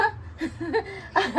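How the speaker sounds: bursts out laughing; a real laugh at son's loss. Real, not acted. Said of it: "ఎందుకు నవ్వుతున్నావు?". (నవ్వు)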